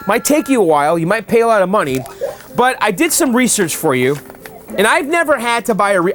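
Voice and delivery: normal talking voice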